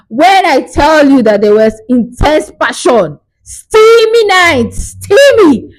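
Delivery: steamy drawn out very high and slow; teasing excitement. Real, not acted. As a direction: tease the juicy part, stretching the word. Gushing about steamy romance.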